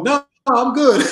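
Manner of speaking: high-pitched, hasty backing off; politely wriggle out of a fight; retold nervous refusal.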